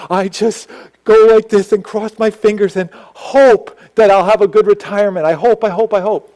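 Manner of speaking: worried friend. Retold or imitated. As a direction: act out a nervous man hoping it works. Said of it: "And what does he say".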